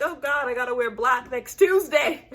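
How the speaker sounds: laughing while faking tears; half laugh, half sob the joke; joking grief, laughing through it; laughing mock cry. Real, not acted. Mock mourning.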